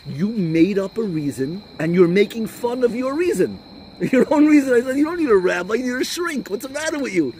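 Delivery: high, loud mock-indignant outburst; retold scolding. Rabbi turning the question back on the questioner, mock indignant. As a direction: tell them off with pitch soaring.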